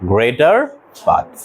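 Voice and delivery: greater part stretched very slowly, rising on greater; slow drawn-out repeat. Shown as a demonstration. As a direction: draw out greater part for the class. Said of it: "অধিকাংশ ইংরেজি কি?".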